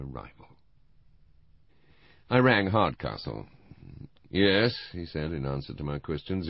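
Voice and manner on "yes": a touch of bitterness, firmly put; hard and bitter. A narrator's reading. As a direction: confirm it in a hard voice. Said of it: "His voice sounded hard and bitter".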